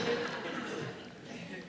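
a brief, quiet laugh at himself; soft laugh. Real, not acted. Preacher laughing at his own tears over a burger, soft laugh. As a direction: laugh off the feeling of being moved.

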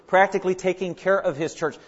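ordinary talk, nothing marked

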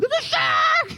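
very high panicked shriek; mock panic. Preacher imagining a fin beside his paddleboard, hammed-up panicked shriek.

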